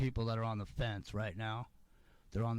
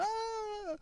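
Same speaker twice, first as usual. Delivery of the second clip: very high imitated scream down the hall; mock scream. Neighbour being dragged out, a high mock scream. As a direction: shriek like someone being dragged off. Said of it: "somebody screaming".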